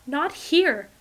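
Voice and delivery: loud sharp refusal. A narrator's reading. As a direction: push him off with the words. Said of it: "her voice is sharper now".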